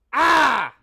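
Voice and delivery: short angry cry at the screen; real gamer rage outburst; rage yell. Real, not acted. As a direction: blurt out a frustrated yell. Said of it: "That's rage".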